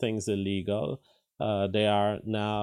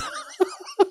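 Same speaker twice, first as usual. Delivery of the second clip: high burst of laughter; amused laugh. Real, not acted. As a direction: laugh out at the absurd mix-up. Host laughs at the clip.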